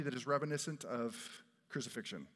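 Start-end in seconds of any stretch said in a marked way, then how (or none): none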